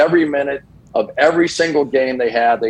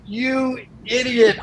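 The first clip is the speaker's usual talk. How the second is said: self-scolding aside; 11 semitones higher, slower than usual. Retold or imitated. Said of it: "And I was like".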